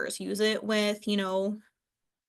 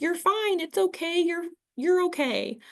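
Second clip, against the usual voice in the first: higher, hurried dismissive reassurance; brushing off; 9 semitones higher than usual. Shown as a demonstration. Counselor mimicking a dismissive reply to a client, brushing off. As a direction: wave the feelings away quickly. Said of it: "instead of being like".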